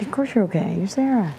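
loud, firm reassurance; emphatic reassurance. Real, not acted. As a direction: insist with warmth.

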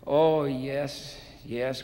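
a relieved, eager yes, lightly voiced; crying out gladly. A narrator's reading. Debtor answers the man saving him from prison, mildly eager cry. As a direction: grab at the offer with quick relief. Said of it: "cried the debtor".

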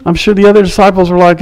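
usual speaking voice

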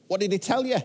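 high-pitched eager question; jubilant. Acted. Jubilant Saul asking Samuel for God's message, excited. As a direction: ask it bubbling over, pitch right up. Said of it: "Saul's still jubilant".

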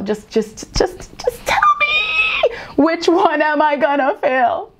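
high, slow crying plea; mock desperate plea. Student begging to know which class she'll fail, mock wail.

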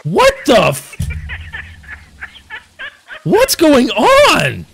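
shocked. Real, not acted. Game glitch, shocked outburst.

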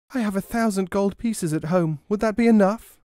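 high, rising anxious plea; distraught cry. A narrator's reading. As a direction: beg desperately. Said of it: "cried the distraught woman".

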